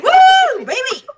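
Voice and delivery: loud high cheer; excited whoop. Real, not acted. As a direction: whoop with joy. Cheering wedding news.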